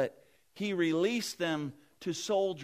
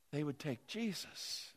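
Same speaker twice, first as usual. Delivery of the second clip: raised, loud preaching voice; 6 semitones higher, 6 dB louder than usual. Acted. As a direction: preach it with rising force. Preacher telling Pilate story.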